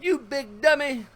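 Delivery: high-pitched mock scolding; playful self-scolding in a high character voice; catchphrase impression. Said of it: "That was my".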